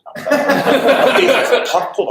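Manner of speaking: high, slow, laughing words. Real, not acted. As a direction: laugh your way through it. Laughing, recalling excitement.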